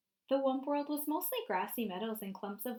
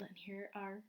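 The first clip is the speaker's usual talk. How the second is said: whispering; 2 semitones lower, 12 dB quieter than usual. Real, not acted. Aside while showing pictures.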